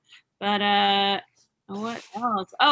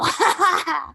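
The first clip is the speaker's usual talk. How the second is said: forced host laugh; 11 semitones higher, 6 dB louder than usual. Retold or imitated. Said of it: "hosts are just always so happy".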